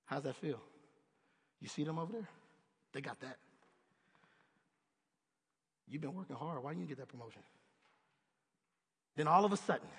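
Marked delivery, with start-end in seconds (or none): 0.0-7.4 low, soft, insinuating tempter; tempter's voice. Retold or imitated. Satan sowing doubt in a weary worker, low and sly. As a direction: lower and quiet, slyly planting doubt. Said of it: "Somebody in Satan comes up".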